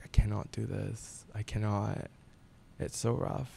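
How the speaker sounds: quietly recalling falling apart; retold breakdown. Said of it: "broke down".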